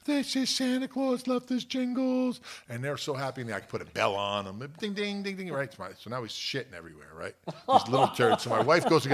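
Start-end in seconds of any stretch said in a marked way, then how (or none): 0.0-2.4 high, slow imitation of thrilled kids; excited children. Voicing his excited children. 4.8-5.5 high sing-song bell jingle; imitated bell.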